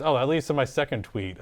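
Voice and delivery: normal talking voice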